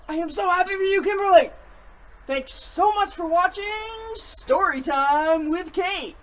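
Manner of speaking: upbeat host outro; excited sign-off. Real, not acted. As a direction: beam through the goodbye. Gushing sign-off.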